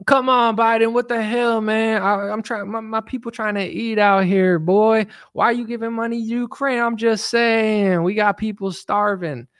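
high-pitched, exasperated street-talk rant. Acted. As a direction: plead with the president in a high put-on voice. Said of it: "based in [name] pilt".

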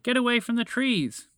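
high, urgent cry; warning shout. A narrator's reading. Moose sees trees turning to chocolate, urgent high shout. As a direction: call out to warn of danger. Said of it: "he shouted".

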